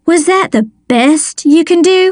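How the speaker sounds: harsh, demanding. A narrator's reading. Mother scolding her daughter at voice lesson, harsh and demanding. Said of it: "A harsh voice, high-pitched and demanding".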